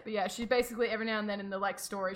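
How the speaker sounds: ordinary talk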